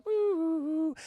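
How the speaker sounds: high, thin hoot; imitated flute note. Storyteller imitates the flute's specific note, high hooting.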